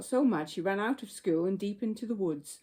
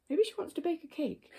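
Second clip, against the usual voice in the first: shopkeeper; 9 semitones higher than usual. Acted. Shopkeeper voiced in story.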